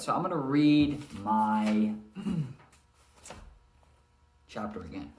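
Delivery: normal talking voice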